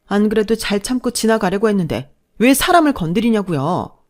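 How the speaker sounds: aggrieved retort with emphatic peaks; angry daughter-in-law. Acted. Daughter-in-law confronting mother-in-law over neglect, hurt and angry. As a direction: let pent-up grievances out.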